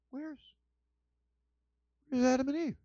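a hint of God searching the garden; God wondering where they went. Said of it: "That'd be God".

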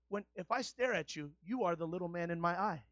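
normal talking voice

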